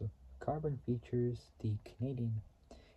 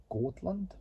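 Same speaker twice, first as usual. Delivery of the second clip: Scotland said higher in a light Scottish accent; 8 semitones higher than usual. Shown as a demonstration. Boasting to viewers, a hint of Scottish accent. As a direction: show off a quick Scottish accent. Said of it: "I can do a Scottish accent sometimes".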